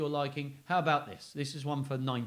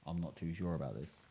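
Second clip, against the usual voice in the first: lower, softer unsure mumble; doubtful teddy; 8 semitones lower, 10 dB quieter than usual. Acted. Teddy bear doubting the ghost, low and unsure. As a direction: voice a nervous teddy. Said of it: "The bear's like".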